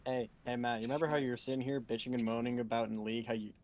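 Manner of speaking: usual speaking voice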